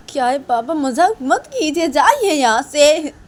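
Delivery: loud, high and slow shouting; angry shout. Acted. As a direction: shout him out, stretching each word. Daughter shouts father away. (गुस्से में धीरे धीरे ज़ोर से चिल्लाना)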